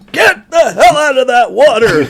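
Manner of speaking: high, loud shouted order with a laugh; old boss yelling at crew in the water; mock boss yell. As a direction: bark it at the kids in the water. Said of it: "boss yelling at young crew".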